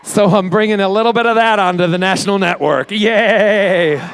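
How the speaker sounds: big showy whoop of celebration; excited cheer. Real, not acted. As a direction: hype it up to the crowd, loud and high. Host cheers own upcoming TV show.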